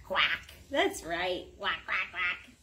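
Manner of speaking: low quack quack. Pretend. Mother teaching her child the duck sound, quacking low.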